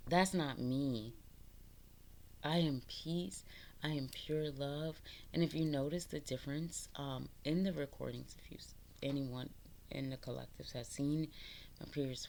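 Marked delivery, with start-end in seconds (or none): none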